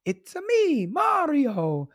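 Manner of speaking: high, bouncy video-game plumber voice; lightly hammed-up Italian plumber; Mario impression. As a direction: do the cartoon plumber catchphrase. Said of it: "He goes".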